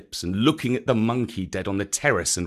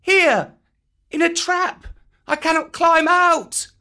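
put-on much higher boy calling up from a trap; crying out child; 5 dB louder than usual. A narrator's reading. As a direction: call out from below, high and urgent. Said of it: "cried Mowgli".

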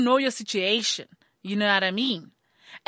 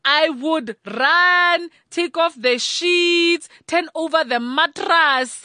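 slow emphatic; 4 semitones higher, 4 dB louder, much slower than usual. Retold or imitated. Frantic mom retelling.

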